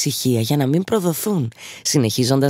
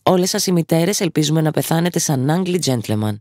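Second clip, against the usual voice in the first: ordinary delivery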